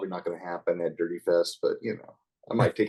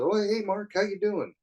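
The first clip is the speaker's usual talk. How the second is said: cheery mock greeting; 11 semitones higher than usual. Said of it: "Gary walked in and said".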